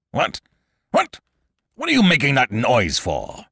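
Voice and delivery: high, loud outraged king; sputter, then demand an explanation; furious demand. A narrator's reading. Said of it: "demanded the king, turning furiously".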